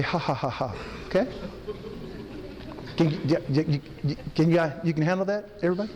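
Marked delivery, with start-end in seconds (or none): 0.0-0.8 low, soft "Ha, ha, ha, ha" on the out-breath; ha ha laugh. Shown as a demonstration. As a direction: chant "Ha, ha, ha, ha" as you exhale. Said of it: "I want you to say".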